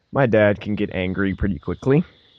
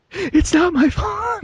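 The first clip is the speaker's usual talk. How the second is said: much higher, slow, drawn-out plea with a laugh; whiny excuse; slower than usual. Retold or imitated. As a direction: stretch out the family's famous line. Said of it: "She's like".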